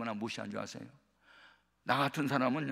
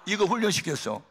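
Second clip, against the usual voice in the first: ordinary talk, nothing marked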